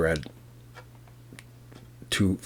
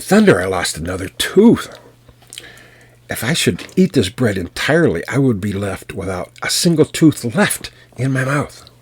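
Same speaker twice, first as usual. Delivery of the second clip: louder, rising pained shout; yelling giant; 4 semitones higher, 5 dB louder than usual. Acted. Giant losing another tooth, shouting in outrage. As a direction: bellow in outrage over lost teeth. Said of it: "he yelled out another scream".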